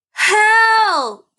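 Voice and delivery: high, loud call for help; cry for help. Shown as a demonstration. Teacher demonstrating an exclamation to kids, loud high cry. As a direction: shout it out like someone in trouble. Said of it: "They are saying it with feeling".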